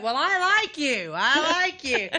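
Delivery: high, loud delighted retort; flattered reply. Retold or imitated. Mom answers the flirting coworker, loud delighted retort. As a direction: play along, tickled. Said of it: "And I went".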